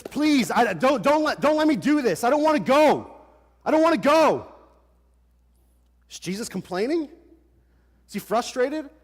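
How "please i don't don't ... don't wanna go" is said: louder, higher desperate plea; pleading anguish. Retold or imitated. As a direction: beg not to go. Jesus voiced pleading in garden.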